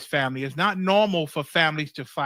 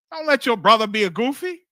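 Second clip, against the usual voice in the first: emphatic; 5 semitones higher, 6 dB louder than usual. Real, not acted. Preacher exhorts the congregation.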